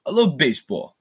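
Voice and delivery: high-pitched mob boss impression; Robert De Niro. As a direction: imitate a movie gangster savoring each line. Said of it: "It's Robert De Niro".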